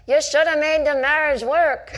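high, loud, slow heckler shout; mock heckler yell. Heckler yells divorce advice at comedian, loud and high. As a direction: bellow it from the front row like a scolding heckler. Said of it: "woman in the front row yelled out".

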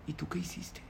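hushed, breathy question; whisper. Retold or imitated. The rabbi quietly asking the groom what he did, whispered. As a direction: lean in and ask in a whisper.

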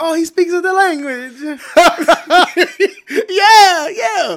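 high, loud, squeaky impressed voice; mock impressed local. Mocking how locals react to his attempts, high and loud. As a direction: gush like an amazed foreigner. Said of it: "It's like".

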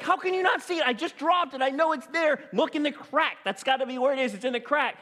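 high-pitched, exasperated dad. Retold or imitated. Dad to daughter searching for his phone, exasperated. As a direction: act out frantic, high-pitched insistence.

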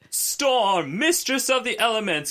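high, slow, grand pronouncement; Storm voice. Retold or imitated. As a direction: declaim it like a goddess. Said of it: "she goes".

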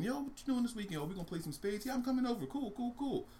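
chatting animal buddies. Acted. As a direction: play two pals making weekend plans. Acts out animals' casual chat.

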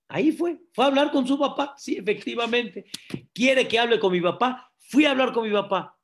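high, loud, insistent burst; emphatic, insistent. Acted. Husband insisting he went to his father, emphatic. Acted indignant husband obeying literally.

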